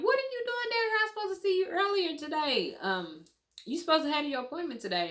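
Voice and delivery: high, surprised doctor's question. Retold or imitated. Doctor asking where the patient has been, high and surprised. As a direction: squeak it up like a startled doctor.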